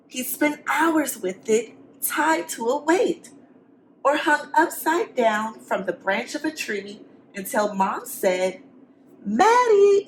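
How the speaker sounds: lively, sing-song storybook reading; reading to children. A narrator's reading. Teacher reading a picture book to the class. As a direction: read it to the kids with rhyme.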